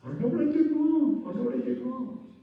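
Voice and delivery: high, strained voice of a baffled father; a lost father insisting he's blameless; pleading father. Retold or imitated. Father telling the rabbi, high and strained. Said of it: "Now stop and think".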